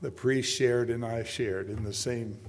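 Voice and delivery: usual speaking voice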